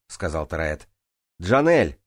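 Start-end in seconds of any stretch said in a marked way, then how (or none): 1.4-2.0 loud, higher-pitched hail; calling out. A narrator's reading. Swimmer hails Джанель waiting above, calling out. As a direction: call to get someone's attention. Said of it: "окликнул её я".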